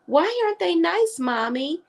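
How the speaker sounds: put-on high small child asking mommy; little girl voice. Brooklyn on the phone asking her mom, high childlike. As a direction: ask it like an innocent four-year-old. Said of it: "And Brooklyn was like".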